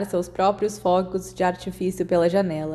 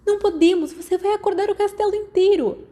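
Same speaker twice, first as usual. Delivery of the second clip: alarmed protest, much higher and louder; 12 semitones higher, 3 dB louder than usual. A narrator's reading. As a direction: plead with them not to wake everyone. Lily protesting the fireworks. (Lily em pânico, bem mais aguda e alta)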